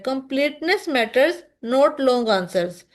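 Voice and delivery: very slow, word by word; slow and emphatic. Shown as a demonstration. Teacher giving students the key rule, slow emphatic delivery. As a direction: hammer the rule home slowly. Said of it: "teacher states key rule".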